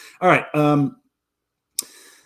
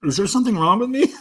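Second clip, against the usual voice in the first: laughing shout; 3 dB louder than usual. Real, not acted. Cracking himself up.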